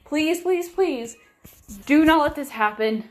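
an urgent repeated please, half angry, half scared; angry desperate pleading. Retold or imitated. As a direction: plead with rising fury. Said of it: "and I was angry".

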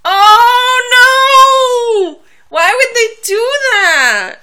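high, sung mock anguish; dramatic wail. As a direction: wail it like heartbreak. Reacting to Jamie Benn picture.

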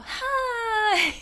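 the hello that makes him cry; high baby-talk hi. Shown as a demonstration. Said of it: "I go".

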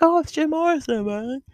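high, spaced-out hippie voice; mellow dreamy hippie. Retold or imitated. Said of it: "That's my hippie impression".

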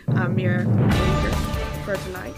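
ordinary talk

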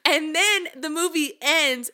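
normal talking voice

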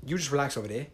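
low, soft put-on British voice; mock British accent. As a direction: say it quietly as a genteel Brit. British accent bit continues.

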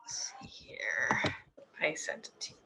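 quiet unvoiced mutter; whispered. Real, not acted. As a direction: whisper to yourself. Muttering while sending link.

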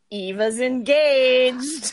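drawn-out, high, loud reveal; excited announcement. Real, not acted. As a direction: sing out the big news proudly. Announces her own engagement to friends.

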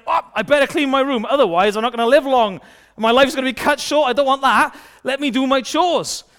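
put-on high, fast, anxious-to-obey kid; hurried compliance. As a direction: rush it out like a child scrambling to obey. Acting child told to clean room.

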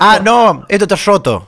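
R cordobesa arrastrada, tono alto; acento cordobés. Shown as a demonstration. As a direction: muestra el acento de Córdoba en "roto". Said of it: "decís las R's en Córdoba". (deje cordobés exagerado)

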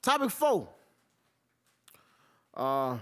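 ordinary talk, nothing marked

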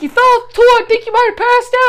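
high, loud worried voice for Steve; Steve's concerned voice. Retold or imitated. Steve tells his dazed wife she passed out, urgent and high. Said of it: "He's like".

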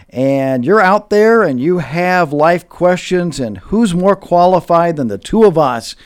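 host hamming up the podcast plug; hyping plug. Acted. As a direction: sell it like a show promo.

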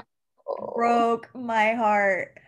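very slow, stretched words; slow, heartfelt emphasis on every word; drawn-out lament. Real, not acted. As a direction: drag out each word in heartbreak.

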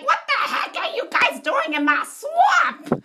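very high-pitched ogre outburst; hammed-up high character voice; Shrek. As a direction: squawk like an indignant cartoon ogre. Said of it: "And Shrek's like".

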